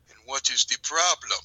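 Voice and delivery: a hint of Italian accent, smooth and slow; lightly voiced Italian charmer; smooth Italian accent asking the problem. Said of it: "that accent".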